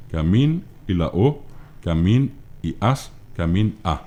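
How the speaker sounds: "camín" slow, then a pause before the ending; split into stem and ending. Shown as a demonstration. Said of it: "Esas son las desinencias".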